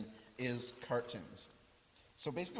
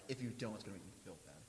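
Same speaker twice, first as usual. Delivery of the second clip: guilt trip; 3 semitones lower, 7 dB quieter, faster than usual. Shown as a demonstration. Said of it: "the guilt trip thing".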